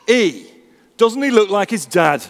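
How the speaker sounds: put-on lightly done Yorkshire boy, chirpy and proud; a hint of a Yorkshire child blurting it out; broad Yorkshire accent. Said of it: "said in a broad Yorkshire accent".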